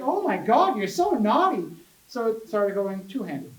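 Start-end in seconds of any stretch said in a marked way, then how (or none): 0.0-1.6 high, loud imitation of a cutesy friend; mock-scandalized cutesy teasing, pitch swooping up. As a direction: coo it loudly, playfully scolding. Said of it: "talking in this really cutesy voice".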